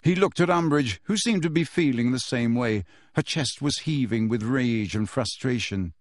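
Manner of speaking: usual speaking voice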